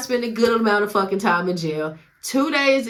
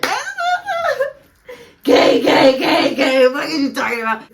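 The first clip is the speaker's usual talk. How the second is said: mocking inmate voice; 9 semitones higher, 11 dB louder than usual. Jeering at the soap-dropping inmate, high mocking shout.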